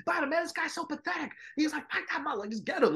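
high-pitched urgent corner yell; imitating angry trainer. Trainer yelling orders at his boxer. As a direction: bark orders at your fighter. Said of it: "Steward was just like".

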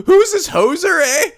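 high-pitched attempt at a Canadian accent. Player voicing a character meeting a stranger, Canadian accent. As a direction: try an excited Canadian accent. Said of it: "So he's supposed to be Canadian".

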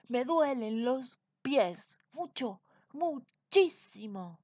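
king whining about his feet; whiny king. A narrator's reading. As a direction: moan about the aching feet. King complains of sore feet.

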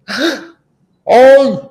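high gasp, then loud yelp; mock frightened gasp. Reacting as the child to the wicked witch, mock frightened yelp.

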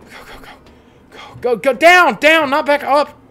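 very high loud shouting; urgent loud go go go; frantic yelling. Real, not acted. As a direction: scream directions in panic. Fleeing monster frantically.

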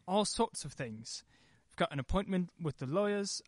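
ordinary talk

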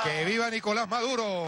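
high rallying cheer; ranting dictator speech. Real, not acted. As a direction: call it out to the crowd. Closing rally cheer to crowd.

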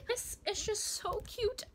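high, slow gush of delight. Real, not acted. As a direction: melt over how cute it is. Gushing over a cute book.

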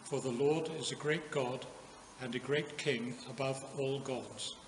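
normal talking voice

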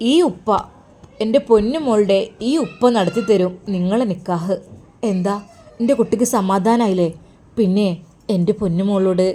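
father promising his weeping daughter she will marry the man she loves; consoling father. Acted. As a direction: reassure her firmly and tenderly, like a protective dad.

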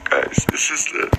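tearful, mostly voiceless and broken up by pauses; close to crying. Real, not acted. Man tells viewers she's been reaching out, tearful. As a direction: struggle to get it out through tears. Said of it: "Don't cry, [name]".

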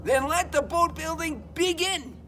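high, loud, slow kickoff call; proclaiming. Acted. Dad bear starting the boat building, proclaiming loudly.

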